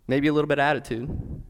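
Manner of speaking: normal talking voice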